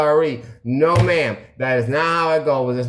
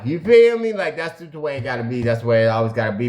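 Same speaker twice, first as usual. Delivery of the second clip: ordinary talk, nothing marked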